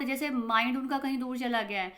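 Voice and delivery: normal talking voice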